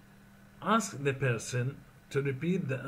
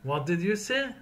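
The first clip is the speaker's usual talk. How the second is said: higher, rising What did you say; puzzled question; 6 semitones higher, 3 dB louder than usual. Shown as a demonstration. Teacher models asking someone to repeat, puzzled. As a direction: sound confused and ask them to say it again.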